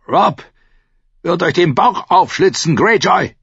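high, loud cry of defiance; furious shout. A narrator's reading. Captive Benfred threatens Greyjoy, shouting. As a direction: shout the threat at your captor. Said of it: "schrie Benfred Tolhard".

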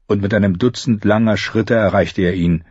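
ordinary delivery

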